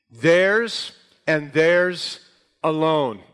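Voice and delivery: "Theirs" drawn out slowly, each one weighted; emphatic THEIRS. Shown as a demonstration. Preacher explains the Greek emphasis, stressing "theirs" slowly. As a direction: hit both "theirs" hard, then a slow "alone". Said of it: "this is what's called emphatic".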